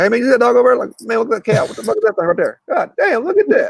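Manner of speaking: voicing his hometown boys, high and hyped up; country buddies. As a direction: clip the last syllable of every word, high and excited. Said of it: "the last syllable of every word you just kinda skip".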